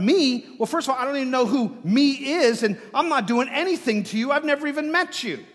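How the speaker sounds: loud, high, fast protest; baffled, rapid-fire self-defence; indignant Saul. Acted. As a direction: protest your innocence in a rush. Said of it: "No doubt Saul probably thought".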